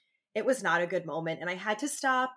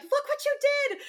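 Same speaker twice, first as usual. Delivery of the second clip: very high, loud mock furious yell; mother raging at daughter over broken cup, mock scream; retold rage; 5 dB louder than usual. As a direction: replay the rage yell at the toddler. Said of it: "like, just rage".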